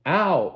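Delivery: sharp cry of pain. Acted. Sound of slap.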